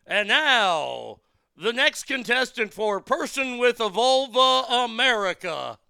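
loud, hammed-up contestant introduction; mock pageant announcer. Emcee introducing the next pageant contestant, mock announcer.

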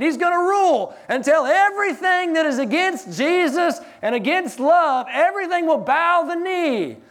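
high, loud preaching build, nearly shouting; full-force preaching. Acted. Pastor preaching to the congregation, loud and high. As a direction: proclaim it over the congregation.

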